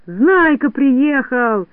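high, very drawn-out cry; stretched, high-pitched hail; excited yell. A narrator's reading. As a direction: yell the news slowly to everyone. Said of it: "завопил Растеряйка".

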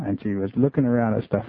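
usual speaking voice

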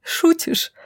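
raspy, airless high question after a laugh; hoarse. A narrator's reading. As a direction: rasp it out in disbelief. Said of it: "Шайни хрипло засмеялась".

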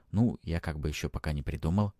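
ordinary delivery

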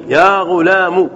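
يا غلام ending in "-mu"; damma on the mim. Shown as a demonstration. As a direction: slowly say يا غلام as "ya ghulamu". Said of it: "ضم الميم".

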